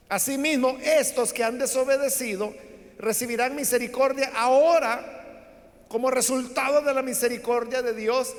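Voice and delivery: lectura solemne, más fuerte; lectura bíblica. Acted. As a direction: proclama el texto a la congregación. Lee el versículo treinta. (el predicador lee el versículo en voz alta y solemne)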